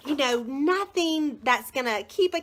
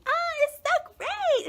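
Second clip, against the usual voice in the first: excited gushing. Real, not acted. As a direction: squeal with delight over a favourite book. Gushing over the story book.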